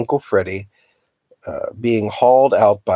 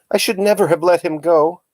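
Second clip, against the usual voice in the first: distressed; 8 semitones higher than usual. A narrator's reading. Aunt distressed after arrest.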